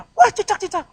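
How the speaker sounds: high, loud startled squeal; mock fright. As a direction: shriek at the gecko in mock panic. Acts frightened at a lizard. (jeritan kaget pura-pura)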